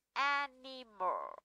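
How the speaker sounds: "animal" drawn out syllable by syllable, AN-I-MAL; slow syllable demo. Shown as a demonstration. Teacher shows how word is read, slow syllable by syllable. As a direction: say "animal" very slowly, each syllable separate, falling. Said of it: "đọc là animal".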